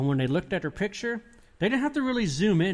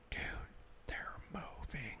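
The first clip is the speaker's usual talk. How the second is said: whispering; 20 dB quieter than usual. Retold or imitated. Cameraman whispering to a friend that they're moving, whispered. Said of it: "he's whispering, going".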